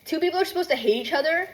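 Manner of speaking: usual speaking voice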